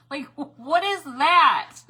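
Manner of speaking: high, loud and drawn out in disbelief; a shocked, slow squeal; mock puzzled shriek. Real, not acted. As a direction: squeal what is that slowly. Mock puzzlement at happy blue.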